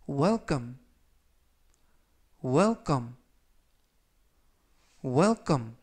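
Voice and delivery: 또박또박 welcome 발음 시범; demo pronunciation. Shown as a demonstration. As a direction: welcome said clearly, three times. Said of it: "이라고 발음하시면 되겠습니다". (또렷하게 welcome 시범)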